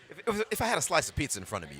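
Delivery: a little Brooklyn accent coming through, fast and loud; light Brooklyn accent over pizza talk; slipping Brooklyn accent. Shown as a demonstration. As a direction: let a touch of Brooklyn slip out. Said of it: "See, there it came out just a little bit".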